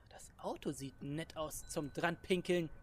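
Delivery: whisper. Acted. Sneaky aside while peeing around.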